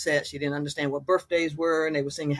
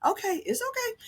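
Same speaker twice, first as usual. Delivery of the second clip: much higher, gentle soothing tone. Retold or imitated. Daughter soothing her upset mother, gentle and higher.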